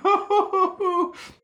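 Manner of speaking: very high, loud sobbing laugh; wailing sob. Acted. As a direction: blubber tearfully. Sobbing sound.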